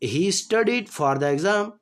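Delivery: slow, clear dictation pace; slow classroom example sentence; slow example read. Shown as a demonstration. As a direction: spell it out slowly for students. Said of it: "teacher reading example sentence".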